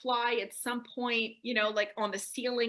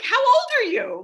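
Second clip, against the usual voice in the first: incredulous outburst; 13 semitones higher, 12 dB louder than usual. Real, not acted. Speaker to the girl, horrified by her story: loud incredulous outburst. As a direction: blurt it out in disbelief.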